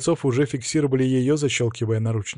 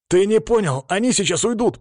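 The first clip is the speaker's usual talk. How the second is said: high, loud desperate insisting; urgent shout; 8 semitones higher, 4 dB louder than usual. Acted. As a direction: press him urgently before they escape. Hero pleads with cop, repeating.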